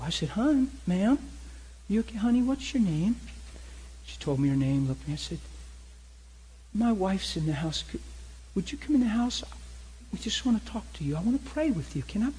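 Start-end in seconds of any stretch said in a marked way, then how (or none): 0.3-3.2 reach out to her tenderly, high and kind; gentle concern. Retold or imitated. Gently calling to a troubled stranger. 6.7-12.3 gentle invitation. Retold or imitated. Inviting the woman inside softly.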